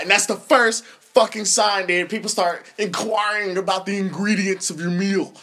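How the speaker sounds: normal talking voice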